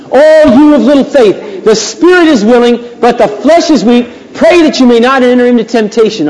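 high, loud preacher's quotation; declaimed rebuke. Acted. Jesus to the disciples who fell asleep, declaimed rebuke. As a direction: proclaim scripture as a forceful reproach.

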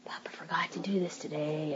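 hushed, grumbling aside; whispered complaint. Retold or imitated. Mother grumbling about Papa to herself, whispered. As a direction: mutter the gripe under your breath. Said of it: "do they hear me complaining".

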